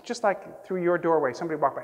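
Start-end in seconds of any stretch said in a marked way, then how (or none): none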